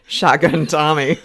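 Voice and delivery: chuckling through the name; laughing. Real, not acted. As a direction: say it while laughing. Joking about a co-host's nickname.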